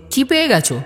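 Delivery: high, bewildered asking; puzzled question. Acted. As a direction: ask as if baffled by his outburst. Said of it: "আমি জিজ্ঞেস করলাম". (অবাক প্রশ্ন)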